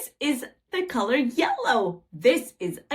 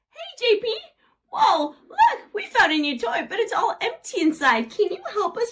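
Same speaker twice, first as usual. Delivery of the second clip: calling out; 9 semitones higher, faster than usual. Acted. Calling the helper friend.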